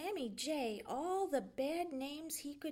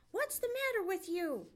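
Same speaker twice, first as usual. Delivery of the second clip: higher, louder snarl at the jay; 9 semitones higher, 5 dB louder than usual. A narrator's reading. Fox snarling up at the jay, higher and louder. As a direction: snap it out, irritated fox. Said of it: "snarled Reddy Fox".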